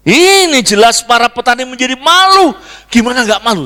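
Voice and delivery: high, loud exclamation; emphatic preaching. Real, not acted. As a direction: proclaim it at full force to the congregation. Preacher exclaims over farmers' shame. (khotbah berapi-api, tinggi dan lantang)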